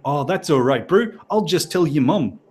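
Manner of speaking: lightly put-on Kiwi accent, easygoing cop; a relaxed New Zealand cop letting it slide, Kiwi accent; mock Kiwi cop. Cop letting the speeder off, in a light Kiwi accent. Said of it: "I think I'm losing the accent now".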